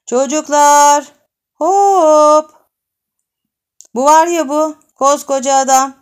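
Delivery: put-on loud, high shout to the kids; girl summoning the other children; calling the gang. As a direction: yell out to the whole street.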